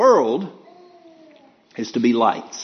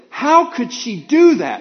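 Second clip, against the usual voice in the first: mock indignation; 9 semitones higher, 3 dB louder, slower than usual. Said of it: "somebody said".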